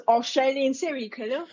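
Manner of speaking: hammed-up Australian accent. Shown as a demonstration. Co-host announcing a serial killer story, Australian accent. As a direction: put on a broad, playful Australian accent. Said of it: "in my Australian accent".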